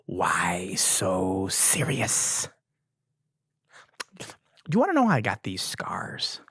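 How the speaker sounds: slow, breathy villain whisper; creepy villain whisper. Pretend. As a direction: hiss it like a movie villain leaning in. Villain movie quote.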